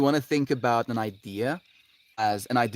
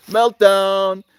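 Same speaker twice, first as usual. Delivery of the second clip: playful shout; 9 semitones higher, 12 dB louder than usual. Real, not acted.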